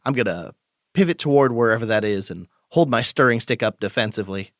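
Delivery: usual speaking voice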